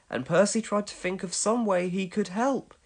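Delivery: plain reading voice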